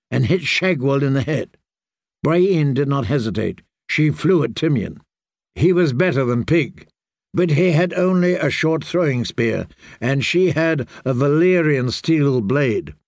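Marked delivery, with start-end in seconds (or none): none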